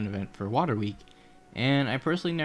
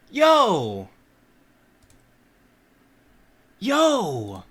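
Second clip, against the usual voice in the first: excited yell; 11 semitones higher, 10 dB louder than usual. Real, not acted. Reaction to shiny catch.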